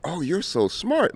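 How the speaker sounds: high, slow, patronizing mock praise; mocking condescension. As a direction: sing it out like a surprised, condescending fan. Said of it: "The one I hate is".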